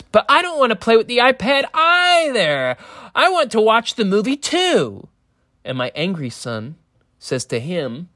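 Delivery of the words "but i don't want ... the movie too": put-on high, whiny little-boy voice, much higher; whiny jealous son. Jealous son whines to his dad about the iPad. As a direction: whine it like a kid left out. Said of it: "my jealous son says".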